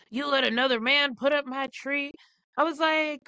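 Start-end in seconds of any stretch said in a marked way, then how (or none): 0.1-2.1 lightly voiced jealous ex, raised pitch; a hint of an offended boyfriend; mimicking jealous ex. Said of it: "he was like".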